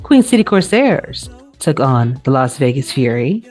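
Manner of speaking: normal talking voice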